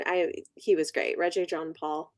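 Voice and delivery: ordinary delivery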